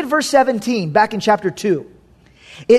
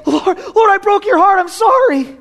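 higher, pleading, mock-contrite repentance; pleading apology; 8 semitones higher than usual. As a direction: beg forgiveness in a hammed-up whine. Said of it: "He doesn't say".

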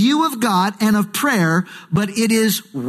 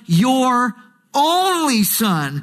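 "only" drawn out high and slow; slow, high-pitched emphasis on "only"; stress on only; 4 semitones higher, much slower than usual. Retold or imitated. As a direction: linger on "your", then stretch "only". Stressing repeated phrase of scripture.

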